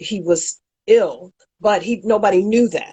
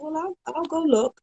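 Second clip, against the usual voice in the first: much softer, even and composed; calm, quiet; 8 semitones higher, 7 dB quieter than usual. Shown as a demonstration. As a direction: answer the boss gently, no edge. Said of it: "just like I'm speaking to you".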